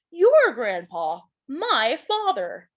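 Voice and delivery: slow, leaning on your grandpa, then my father; Pa correcting Laura. Acted. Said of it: "said Pa".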